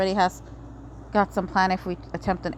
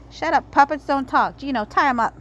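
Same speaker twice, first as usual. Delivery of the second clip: irritated snap; 5 semitones higher, 4 dB louder than usual. Acted. Villain silences puppet.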